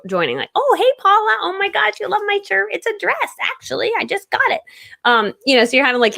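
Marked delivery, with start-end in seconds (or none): 0.5-4.6 much higher, gushing chatter to a viewer; bubbly banter. Shown as a demonstration. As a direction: gush at a live viewer like a best friend. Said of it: "do a little banter with the people".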